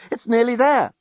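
high-pitched cry of urgency; alarmed yell. A narrator's reading. Journalist realising the bomb is close, yelling. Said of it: "yelled the journalist".

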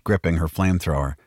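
ordinary delivery, nothing marked

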